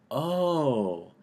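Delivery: soft, drawn-out "oh" of realization; dawning realization. Retold or imitated. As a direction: imitate a quiet little breakthrough moment. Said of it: "like, a little breakthrough".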